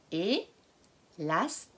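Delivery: Élastique said very slowly in four beats; slow syllable-by-syllable. Shown as a demonstration. Teacher counts syllables of Élastique for children. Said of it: "on dira quatre syllabes".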